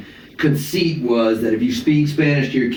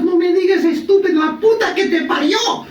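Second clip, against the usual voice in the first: put-on much higher, laughing, in a thick Argentine accent; "no me digas" in a high, laughing Argentine mom voice. Mom scolding her son, in a high Argentine accent. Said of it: "You know, strong accent".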